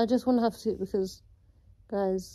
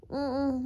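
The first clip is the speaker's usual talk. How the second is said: pained hum; 4 semitones higher than usual. Real, not acted. In pain after surgery.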